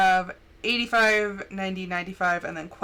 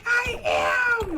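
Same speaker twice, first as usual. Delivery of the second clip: yelling; 14 semitones higher than usual. Retold or imitated. Daughter yelling back at her mom. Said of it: "Don't yell at me".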